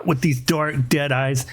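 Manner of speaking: normal talking voice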